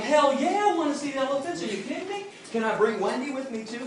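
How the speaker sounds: high, loud, thrilled yes; eager excitement. Retold or imitated. Invited to see the elephants, shouting eager excitement. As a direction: burst out like a kid invited backstage. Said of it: "I'm like".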